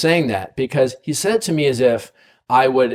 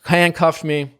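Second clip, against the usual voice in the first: usual speaking voice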